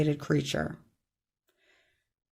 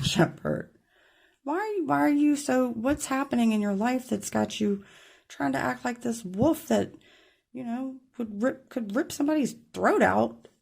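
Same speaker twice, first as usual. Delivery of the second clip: much higher, exasperated pleading; exasperated shaking; 8 semitones higher than usual. Pretend. Tarot reader pretending to shake the viewer, high exasperated pleading. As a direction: throw up your hands and plead in a high voice. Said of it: "and be like".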